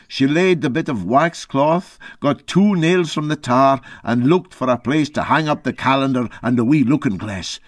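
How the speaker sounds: ordinary delivery, nothing marked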